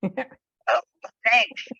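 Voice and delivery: usual speaking voice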